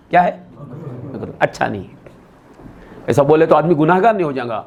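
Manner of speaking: ordinary talk, nothing marked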